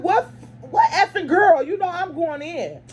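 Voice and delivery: high, slow, indignant demand; retold angry outburst; mock outrage. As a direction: act like the mom ready to go off. Mom reacting angrily on phone.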